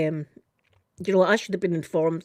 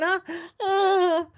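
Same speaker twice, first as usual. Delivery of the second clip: put-on high, pained child cry, much higher; imitating grandson's cry; 10 semitones higher, 3 dB louder than usual. Grandma imitating grandson crying during a phone call. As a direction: act out the toddler's fake howl. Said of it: "and I was like, "Oh my God".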